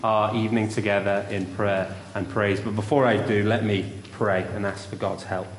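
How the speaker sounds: usual speaking voice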